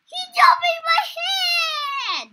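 high, loud, slow scared cry; frightened crying shout. Real, not acted. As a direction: shriek it in real panic. Monster jumps at player.

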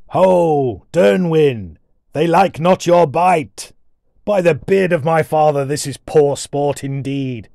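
loud, slow, booming warrior's call; booming dwarf. A narrator's reading. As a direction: boom it out like a little Viking. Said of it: "The deep voice boomed".

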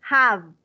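"Have" ending in a clear V, lip bitten; final V. Shown as a demonstration. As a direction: con la V final marcada. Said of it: "se pronuncia v".

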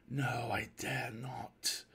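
slow, whispery refusal; longing held back, barely voiced; stifled craving. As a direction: hush it, dragging out each word. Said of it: "in its tones I detected a note of stifled craving".

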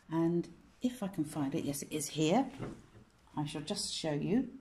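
usual speaking voice

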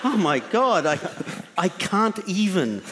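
mock shock. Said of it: "It's not like".

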